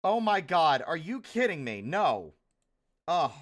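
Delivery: loud frustrated outburst; raging gamer. Real, not acted. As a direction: yell at the broken program. Drawing program breaks, frustrated.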